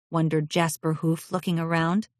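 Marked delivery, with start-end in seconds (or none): none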